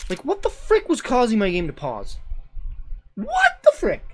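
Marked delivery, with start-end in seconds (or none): 3.1-4.0 much higher, disbelieving cry; spooked, jumps way up in pitch; spooked squeak. Real, not acted. Spooked streamer exclaims.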